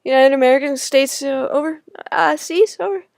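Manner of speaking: loud, high flustered stammer; flustered jumble. Retold or imitated. Put on the spot by the shop woman, loud flustered stammering. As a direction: blurt it out in a panicky muddle. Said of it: "A jumble of".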